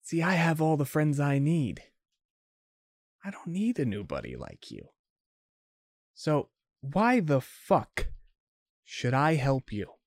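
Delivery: a hint of a sneering gang boss; brushing him off with light scorn; dismissive Hannah. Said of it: "shrugs and, and says".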